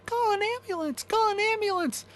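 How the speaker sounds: high, loud frantic wailing; wife wailing for an ambulance; wailing plea. Acted. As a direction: wail it out in panic. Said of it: "She kept wailing at me".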